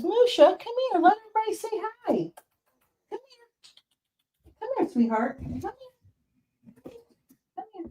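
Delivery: sweet high-pitched pet talk; coaxing a pet. Real, not acted. Calling her cat over to the camera.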